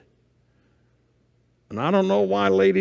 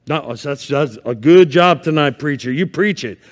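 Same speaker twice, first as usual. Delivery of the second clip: ordinary talk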